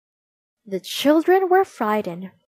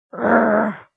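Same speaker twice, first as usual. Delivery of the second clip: growl; 4 dB louder than usual. Acted. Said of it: "said the soldiers".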